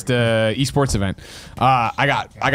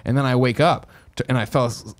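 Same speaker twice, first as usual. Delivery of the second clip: normal talking voice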